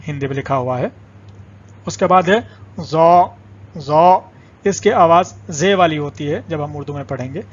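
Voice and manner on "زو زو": زو loud, thick z with long "aw" vowel; heavy z letter. Shown as a demonstration. Teacher introduces the letter to learners, emphatic heavy زو. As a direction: drill زو firmly, drawn out and heavy. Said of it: "اصل آواز تھوڑی سی مختلف ہے".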